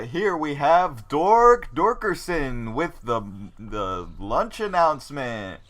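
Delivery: slow, stretched radio host intro; announcer introduction. Acted. Jake introducing Dork Dorkerson as encouragement, drawn-out announcer voice. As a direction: draw out the name like a hyped announcer. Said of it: "So Jake's like".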